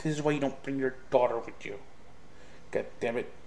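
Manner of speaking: muttered retort. Retold or imitated. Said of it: "I'm like".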